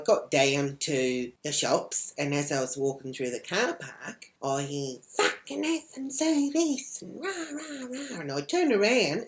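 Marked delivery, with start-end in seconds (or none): 5.1-8.2 high-pitched mock rant, stretched out; ballistic ranting. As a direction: imitate a woman going ballistic, high and drawn out. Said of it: "this bird is going ballistic in her car".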